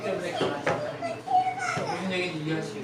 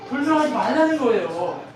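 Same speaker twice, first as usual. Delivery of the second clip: loud, high-pitched sermon delivery; impassioned preaching; 10 semitones higher, 9 dB louder than usual. Real, not acted. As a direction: drive the point home at full force. Preaches at full force.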